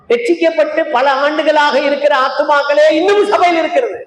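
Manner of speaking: high-pitched, driving sermon delivery; impassioned preaching. Acted. Preacher challenging saved souls on why the church has not grown, impassioned.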